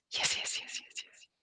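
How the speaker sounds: soft whisper, fading as it repeats; whispered echo. Shown as a demonstration. Partner echoes the coach's whispered yeses, fading whisper. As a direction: whisper it back, getting fainter.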